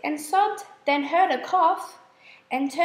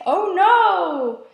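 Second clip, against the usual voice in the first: a loud, alarmed little shriek in a new voice; 3 semitones higher, 7 dB louder than usual. A narrator's reading. Timid beetles shrieking at the spider, loud frightened cry. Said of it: "they shrieked".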